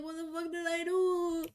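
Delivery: high, strained near-tears pleading; high, near-tears retelling of panic; almost crying. Retold or imitated. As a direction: plead in rising distress, close to tears. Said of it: "literally almost crying".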